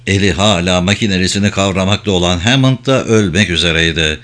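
ordinary delivery, nothing marked

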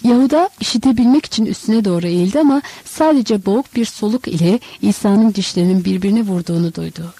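ordinary delivery

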